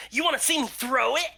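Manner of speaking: put-on quick boastful child; little boy voice. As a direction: show off like a small boy.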